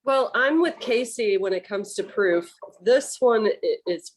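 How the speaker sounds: ordinary talk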